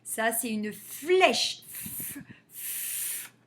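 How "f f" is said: "f" as a soft voiceless hiss. Shown as a demonstration. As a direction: breathe out the "f" sound. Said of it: "on entend le F".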